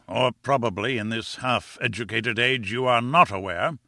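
pompous afterthought, lifting at "not aware"; disdainful aside. Acted. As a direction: drop down into a condescending correction. Lecturer scornfully corrects himself.

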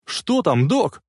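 calling into a radio; shouted question. A narrator's reading. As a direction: raise the voice to ask urgently. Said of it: "прокричал он в рацию". (крик в рацию)

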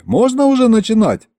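high, called-out question; shout from the crowd. A narrator's reading. Townsman asks the master to let them start, shouting. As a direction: holler the question from afar. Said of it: "крикнул кто-то из толпы".

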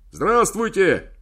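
slow, loud shout into a horn. Acted. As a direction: shout so a deaf man hears. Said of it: "прокричал я в рожок".

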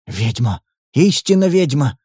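plain reading voice

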